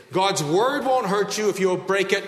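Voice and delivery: usual speaking voice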